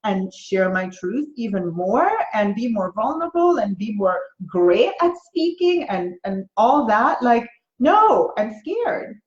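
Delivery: high, laughing mock panic; panicked ego voice. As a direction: play the scared ego squealing at the ledge. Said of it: "everything inside me went".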